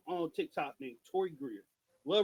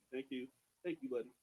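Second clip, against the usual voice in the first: much softer and lower, praising the dog; soft praise to dog; 8 semitones lower, 10 dB quieter, faster than usual. Real, not acted. Owner thanking dog Blue for lying down, soft. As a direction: murmur thanks to your pet.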